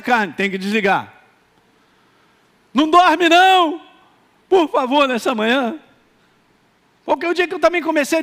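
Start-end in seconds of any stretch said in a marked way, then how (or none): 2.7-5.8 rousing call. Real, not acted. Pastor waking up the morning congregation, loud and high. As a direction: jolt the sleepy crowd awake. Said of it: "rousing congregation".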